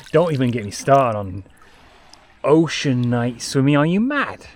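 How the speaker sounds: loud, rising disbelief; incredulous outburst. Real, not acted. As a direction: exclaim it like it's crazy. Mock outrage at night swimming.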